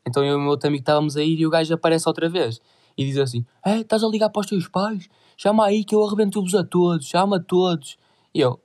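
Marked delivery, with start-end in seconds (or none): 3.6-8.0 higher-pitched mocking threat; threatening stranger. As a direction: do the thug daring them to call their parents. (ameaça trocista, mais aguda)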